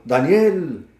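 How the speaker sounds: a loud, high cry of the name; calling out sadly. A narrator's reading. King calling into the lions' den, loud sorrowful cry. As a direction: call his name into the den, grieving. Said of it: "a voces, gritando".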